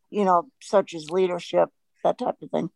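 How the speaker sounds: ordinary delivery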